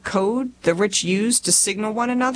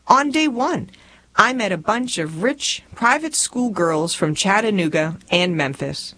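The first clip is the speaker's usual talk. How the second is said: plain reading voice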